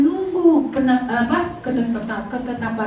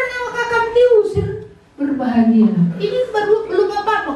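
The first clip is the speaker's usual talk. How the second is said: laughing retold advice; 7 semitones higher than usual. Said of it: "aku bilang".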